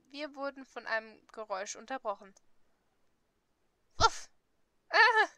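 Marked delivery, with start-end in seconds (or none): 4.0-4.3 startled grunt. Acted. Startled by a jumping dog. 4.9-5.3 high loud startled shriek; startled scream. Acted. Player startled by the dog, shrieks high and loud.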